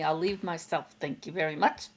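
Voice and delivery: ordinary talk, nothing marked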